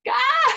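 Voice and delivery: loud, very high comic shriek; hammed-up scream of frustration; high-pitched mock scream. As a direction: shriek out as the panicking ego. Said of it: "And my little ego".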